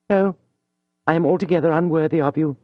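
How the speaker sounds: a proud lady suddenly meek; humble young woman. Acted. Mathilde to Julien, pale and humbly pleading.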